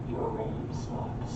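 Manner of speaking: slow, unvoiced gravel growl; low gravel vigilante growl; gravelly voice. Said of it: "this gravelly voice going".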